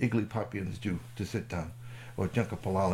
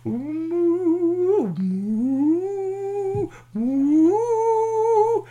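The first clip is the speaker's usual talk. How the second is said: very high, swelling alien hum; long sustained high moo-like tones; humming; 8 dB louder, much slower than usual. Acted. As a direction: hum oddly, like an alien digesting. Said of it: "And he sat there humming".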